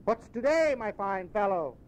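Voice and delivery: high, eager, then slowing warmly; an old man suddenly beaming at a stranger; delighted Scrooge. Acted. Scrooge asking the boy the day, delighted.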